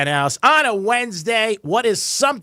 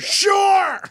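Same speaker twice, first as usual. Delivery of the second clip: high, shouted one-word reply; mock-testy. Host playing husband asked out to dinner, mock-testy shout. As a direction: yell it like an exasperated husband.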